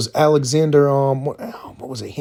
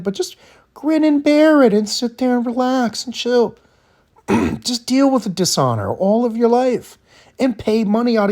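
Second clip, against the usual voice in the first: higher-pitched mock advice in her role; mocking this lady; 8 semitones higher than usual. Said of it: "Imagine if we listened to this lady".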